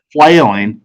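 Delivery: ordinary delivery, nothing marked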